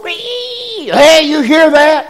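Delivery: very high squeaky door voice; creaking door voice. Retold or imitated. The loose door answering in the night, squeaky creak voice. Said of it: "the door's going".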